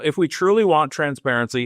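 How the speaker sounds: usual speaking voice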